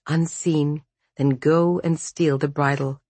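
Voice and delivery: plain reading voice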